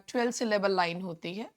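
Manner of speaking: usual speaking voice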